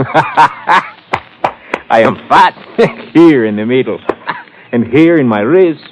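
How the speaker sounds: chuckling through the words, slow; a self-mocking chuckle about getting fat; laughing. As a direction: laugh at your own belly as you say it. Chauffeur laughs at himself.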